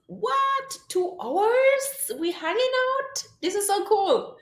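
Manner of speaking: light up like you just heard great news; excited surprise. Real, not acted. Reacting to a viewer's comment.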